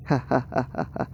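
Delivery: short superior chuckle; smug laugh. Acted. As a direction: laugh down at the small fry. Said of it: "एसी ऐसे बोल के हंसता है". (घमंडी हँसी)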